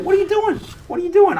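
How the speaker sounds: high, loud mock scolding of the chat; mock outrage. Streamer playfully scolding viewers who haven't subscribed. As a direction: squeal it in mock disbelief.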